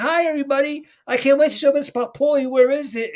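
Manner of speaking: put-on very high, loud, eager child voice; happy Michelle. Michelle eager to show her grandpa, happy high voice. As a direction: imitate an excited child calling out high. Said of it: "She's fucking happy".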